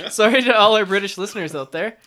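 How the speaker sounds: amused, laughter bubbling through; laughing. Real, not acted. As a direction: say it while cracking up. Laughing at the failed accent.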